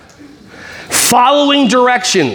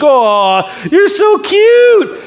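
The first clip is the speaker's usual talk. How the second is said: put-on cooing at a cute kid; 9 semitones higher, much slower than usual. Teacher gushing at a cute child, high slow coo. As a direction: gush over the little princess.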